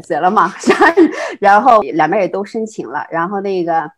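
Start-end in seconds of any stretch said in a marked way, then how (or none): 0.6-1.3 high laugh. Real, not acted. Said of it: "学霸".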